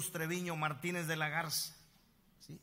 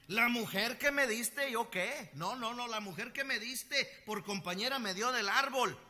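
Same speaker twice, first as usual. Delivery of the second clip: higher, louder whiny blame-shifting; Adam protesting, pitched up and loud; whining Adam; 8 semitones higher, 4 dB louder than usual. Acted. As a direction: whine to dodge the blame. Voicing Adam blaming, whining.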